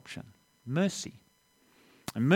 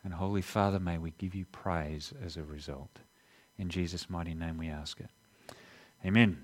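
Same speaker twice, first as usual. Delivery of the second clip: soft, hushed closing prayer; 5 semitones lower, 7 dB quieter than usual. Acted. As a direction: pray it gently to close.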